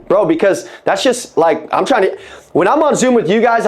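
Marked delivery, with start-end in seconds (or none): none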